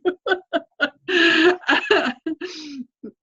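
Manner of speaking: high laugh mixed with crying; wet, sobbing chuckle; tearful laugh. Real, not acted. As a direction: laugh it off through real tears. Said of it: "this is making me cry".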